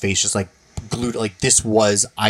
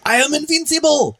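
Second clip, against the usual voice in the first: mock villain yell. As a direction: shout it like a gloating video game villain. Quoting the GoldenEye villain's yell.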